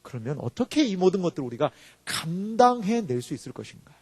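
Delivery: ordinary talk, nothing marked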